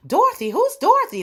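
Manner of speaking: high, loud puzzled cry; puzzled townsfolk. Acted. Oz townsfolk baffled by the sky writing, high and loud. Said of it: "They was like".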